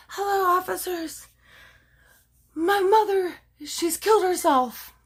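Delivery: very high, put-on tearful voice; mock distraught caller. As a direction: badly fake grief to the police. Acts daughter's fake emergency call.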